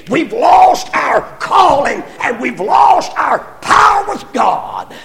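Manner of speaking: loud, slow, rising preacher's cry; preaching shout. Real, not acted. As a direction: shout it out from the pulpit. Preacher rises to full force.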